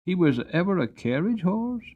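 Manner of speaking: put-on a curious boy asking his grandpapa; boy asks grandfather about the horse, high and eager; young boy.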